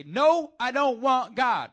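high, loud flat-out refusal; hammed-up defiant no; defiant shout. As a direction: yell the rejection right at them. Acting a defiant rejecter.

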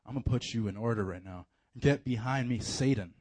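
low, commanding mock rebuke; stern Jesus. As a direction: set Peter straight with quiet authority. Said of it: "he's like".

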